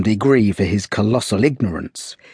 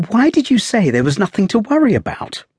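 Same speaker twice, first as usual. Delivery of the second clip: put-on young woman; 12 semitones higher than usual. A narrator's reading. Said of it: "asked the girl".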